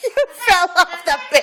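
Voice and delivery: laughing loudly through the words; giggling at a pet's tumble. Real, not acted. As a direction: crack up while teasing the bird. Laughing at the fallen bird.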